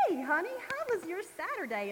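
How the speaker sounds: a cheerful little bird wife asking about his day; bird's wife. Acted. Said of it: "and the wife saying".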